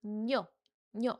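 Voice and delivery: 뇨 starting with a clear n; assimilated n sound. Shown as a demonstration. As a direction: sound out 뇨 with n replacing the r. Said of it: "this 리을 sound changes to 니은".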